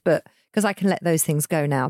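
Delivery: usual speaking voice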